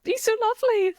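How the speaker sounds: very high-pitched swooning gush; mock gushing. Biased co-host mocking own argument, high gushing. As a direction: send yourself up as a smitten fan. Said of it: "I'm just like".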